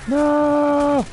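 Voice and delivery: high loud shriek; screaming no. Real, not acted. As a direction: shriek it in panic. Character dies in game.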